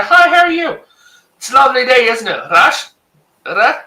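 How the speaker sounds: high fake German gibberish accent. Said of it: "just put on an accent and speak gibberish".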